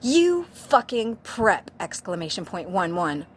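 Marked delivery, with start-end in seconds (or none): 0.0-1.7 slow, loud furious yell; hammed-up goth fury; angry yell. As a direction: spit out the insult slowly and hard. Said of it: "We all yielded angrily".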